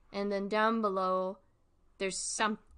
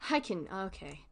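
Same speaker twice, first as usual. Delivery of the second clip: usual speaking voice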